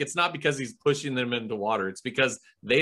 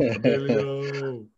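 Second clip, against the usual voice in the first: long drawn-out playful name call; slow mock wail; 3 semitones higher, much slower than usual. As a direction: stretch the name out, joking. Keeps up the mock cry.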